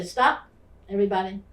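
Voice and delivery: loud sharp call to the class; commanding shout. Retold or imitated. Teacher stopping a class fight, loud commanding call. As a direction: bark it to stop the fight.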